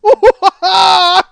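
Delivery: high, loud burst of laughter; big laugh. Real, not acted. Comic cracking up over the stabbed woman, big high laugh. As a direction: crack up loudly and helplessly.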